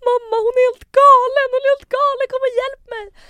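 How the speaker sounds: very high, loud shriek for help; shrieking re-enactment of desperate panic; acted panicked scream. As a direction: scream for mum in re-enacted terror. Retold panicked call to mother.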